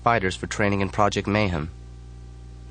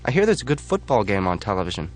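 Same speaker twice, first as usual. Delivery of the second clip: usual speaking voice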